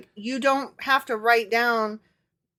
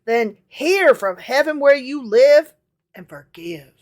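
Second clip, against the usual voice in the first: louder, solemn Bible verse; solemn scripture reading; 2 semitones higher, 8 dB louder than usual. Acted. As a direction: proclaim it like a preacher. Reads scripture aloud.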